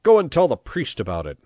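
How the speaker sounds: loud, high, angry bawl. A narrator's reading. Chief dismissing his henchman, bawling. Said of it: "bawled Pepone".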